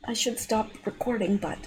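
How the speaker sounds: quiet strained voice in pain; through cramp pain. Real, not acted. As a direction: hold the words tight through pain. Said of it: "I got a foot cramp".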